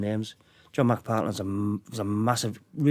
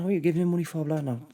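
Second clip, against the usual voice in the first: higher, softer mock complaint; a hint of mock nagging; lightly imitated nagging wife; 5 semitones higher, 5 dB quieter, faster than usual. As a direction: lightly mimic a wife's nagging question. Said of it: "As women are".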